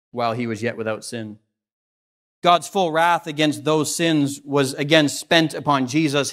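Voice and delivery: usual speaking voice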